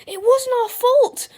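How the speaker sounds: high, loud, drawn-out plea; protesting cry. A narrator's reading. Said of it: "cried Harold".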